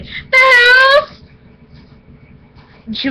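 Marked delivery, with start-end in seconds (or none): 0.0-1.2 high, loud fake-teary whine; pitiful little wail; mock whimper. Answering mom where she's stranded, fake-teary whine. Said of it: "I was like".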